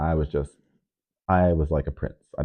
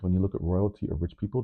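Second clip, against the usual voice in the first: ordinary talk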